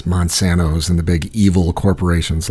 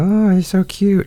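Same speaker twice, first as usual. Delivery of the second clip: high, slow cooing over a cute animal; gushing baby talk; 11 semitones higher, slower than usual. Retold or imitated. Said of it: "everybody looks at the deer and they go".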